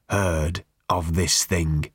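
slow, low menace; muted savagery. A narrator's reading. Barman rejecting Ford's card, muted savagery. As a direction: savage disdain kept under control, drawn out. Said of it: "he said with muted savagery".

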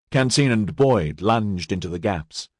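plain reading voice

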